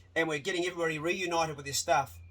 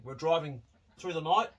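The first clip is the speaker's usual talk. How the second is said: ordinary delivery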